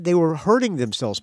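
normal talking voice

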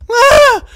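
very high loud shriek; sudden piercing scream of fright; frightened scream. Real, not acted. Player not good with horror games, frightened scream.

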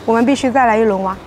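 ordinary talk

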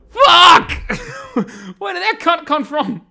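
a loud shout that breaks into a laugh; furious yell. Real, not acted. Gamer ambushed by an enemy, shouting then laughing.

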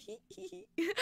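high, soft imitated giggle; mimicking the player giggling while signing; imitating giggle. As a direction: giggle lightly like the shy player. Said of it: "like, giggling".

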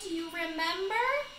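ordinary delivery, nothing marked

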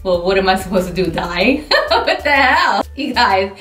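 ordinary talk, nothing marked

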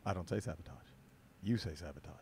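very low and very quiet, deliberate. Retold or imitated. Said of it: "he goes".

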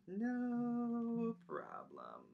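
slow, sly, smug No problem; drawn-out teasing. Acted. Teacher teasing kids with a slow smug No problem.

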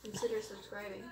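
low, quiet impersonation voice; Levi. Shown as a demonstration. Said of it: "let me do it in Levi's voice".

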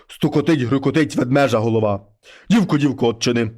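low, heavy voice of a wolf head at the door; low monster head voice. Acted. Wolf head knocks and calls the girl, low and heavy.

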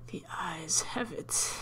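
slow, hushed whisper; slow spooky whisper. Pretend. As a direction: whisper the pun slowly. Whispered joke aside.

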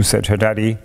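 ordinary delivery, nothing marked

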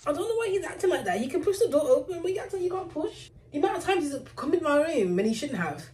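pet voice. Real, not acted. Talking about her dog.

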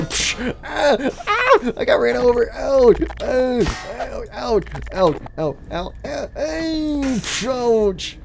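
high yelps trailing into whimpers; hammed-up pain. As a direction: play at being run over. Acts getting run over.

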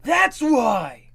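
loud high character shout. Acted. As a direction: yell it out suddenly.

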